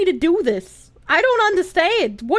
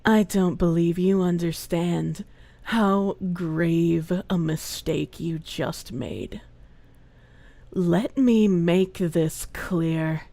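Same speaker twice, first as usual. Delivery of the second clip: much lower, slow, soft menacing voice; movie villain threat; 10 semitones lower, 4 dB quieter, slower than usual. Pretend. Streamer playing a menacing villain toward a viewer. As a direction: threaten them like a film villain, low and slow.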